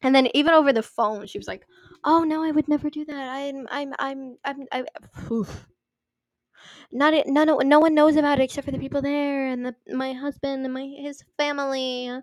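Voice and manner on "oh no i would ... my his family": higher, flustered polite protest; mock-polite stammering reassurance; overly polite. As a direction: imitate someone falling over themselves to be polite. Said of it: "trying to be so polite about it".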